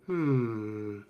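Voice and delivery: a lightly voiced scholarly giant muttering to himself; musing giant. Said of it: "he kind of looks over at you and goes".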